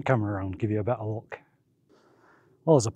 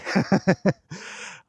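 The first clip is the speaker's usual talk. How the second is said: breathless laugh; 6 semitones higher than usual. Real, not acted. Laughs at his own breathlessness.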